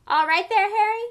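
a high, loud shout on the fly; Fred shouting to Harry mid-chase; yell. A narrator's reading. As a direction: yell it while rushing past. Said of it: "he had time to yell".

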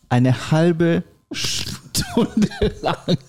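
laughing. Real, not acted. Laughing at punchline.

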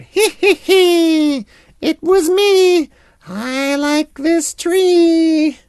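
high, slow, giggly monkey voice; cheeky monkey. A narrator's reading. Monkey teases the lion from the tree, high and giggly. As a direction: sing it out like a sneaky little monkey. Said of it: "the lion heard".

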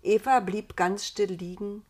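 usual speaking voice

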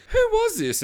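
very high, put-on woman's voice; high mimicked female question; wife's voice. Wife voiced looking at camera footage.

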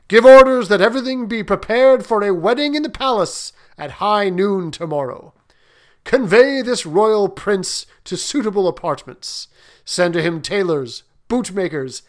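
high, loud royal commands; booming warrior king. Acted. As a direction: bark orders to the officers. King commands his officers.

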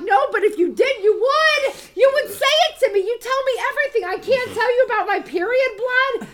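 loud, high, indignant acted protest; heated comeback. Sister accusing her brother of double standards, heated and indignant. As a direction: escalate into a rapid, outraged argument.

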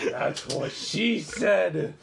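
high, slow silly voice; put-on joke voice. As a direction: drawl the old joke in a silly voice.

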